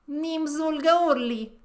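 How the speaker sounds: put-on slow, loud Russian-accented intro; card dealer introducing herself in a Russian accent. Witness introducing herself to the court, Russian accent. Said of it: "she doesn't have a Russian accent".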